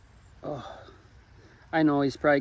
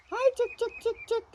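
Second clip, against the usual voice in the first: calling chickens; 7 dB quieter, faster than usual. Real, not acted.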